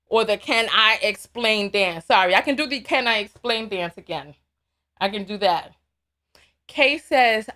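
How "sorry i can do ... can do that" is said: ordinary talk, nothing marked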